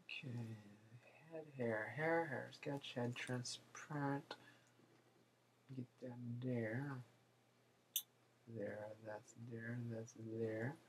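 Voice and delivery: very soft absent-minded mumble; muttering to himself. Real, not acted. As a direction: talk quietly under your breath. Muttering to himself while drawing.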